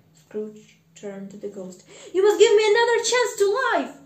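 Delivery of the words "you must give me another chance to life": loud, high, rushed begging; desperate plea. Acted. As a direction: beg for your life, fast and shouting. Scrooge begs the ghost for life.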